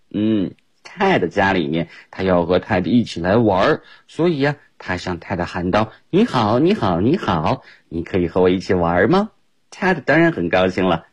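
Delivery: ordinary delivery, nothing marked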